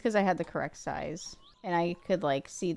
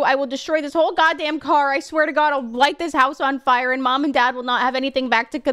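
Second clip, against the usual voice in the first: loud, furious, rapid rant; angry rant; 4 semitones higher, 8 dB louder than usual. Real, not acted. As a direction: explode at the screw. Raging over a lost screw.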